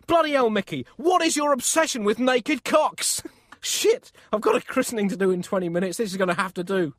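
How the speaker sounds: high, loud comic exasperation; exasperated rant. Acted. Pope pressed for time berates the painter, exasperated. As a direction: berate him, worked up and pitched high.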